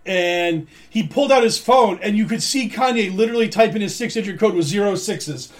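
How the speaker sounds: usual speaking voice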